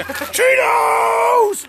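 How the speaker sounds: high, drawn-out shout; excited shout. Real, not acted. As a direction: call out long and loud. Calling for snacks.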